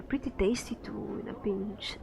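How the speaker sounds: soft confiding aside; whisper. Acted. Merchant confiding a secret to the player, hushed. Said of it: "leans close to whisper".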